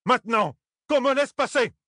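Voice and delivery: very high-pitched, imperious yell; roaring demand. A narrator's reading. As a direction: roar the order with full authority. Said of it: "rugit Thalène". (rugissement autoritaire, très aigu)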